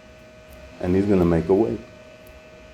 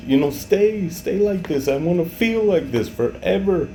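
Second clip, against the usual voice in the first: high, strained plea, close to tears; tearful pleading; 10 semitones higher than usual. Real, not acted. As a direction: beg it to stay, overcome by feeling. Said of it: "get a little emotional".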